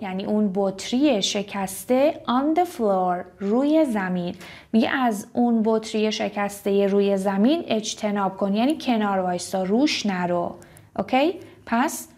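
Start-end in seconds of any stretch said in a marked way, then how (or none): none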